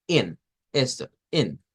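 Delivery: IN of English on its own, low and falling; stressed first syllable. Shown as a demonstration. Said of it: "es la sílaba tónica". ("in", la primera sílaba tónica, aislada)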